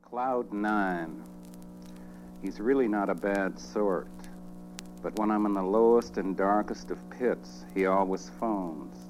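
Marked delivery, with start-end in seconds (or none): none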